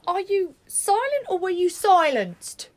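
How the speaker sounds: high, loud, slow interrogation; slow, ringing challenge; dramatic demand. Acted. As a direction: draw it out with theatrical weight. Mock dramatic demand of colleague.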